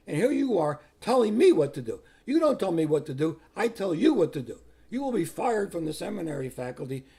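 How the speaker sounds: acted-out angry letter, loud and emphatic; retold stern dressing-down, hammering each point; scolding authority. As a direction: lay down the law as an offended superior. Reads out the cardinal's scolding letter.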